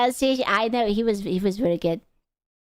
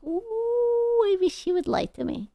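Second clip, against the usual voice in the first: mock regret; 11 semitones higher, faster than usual. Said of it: "and then you're like".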